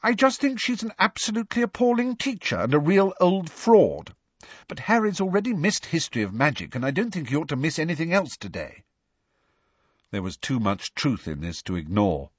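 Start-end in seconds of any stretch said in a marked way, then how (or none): 0.0-4.1 high, indignant student character voice; high-pitched, disapproving pronouncement. A narrator's reading. Student complaining about a fraud of a teacher, indignant. 4.7-8.8 quick, higher-pitched student voice; brisk, fussy objection; disapproving student. A narrator's reading. Student fretting over Harry's missed class, disapproving.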